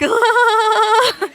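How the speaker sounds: a high, far-off nervous giggle. Shown as a demonstration. Showing parents what a nervous giggle sounds like. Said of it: "The giggle is engaging your proprioceptors".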